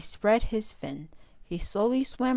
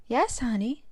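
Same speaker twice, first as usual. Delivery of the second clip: usual speaking voice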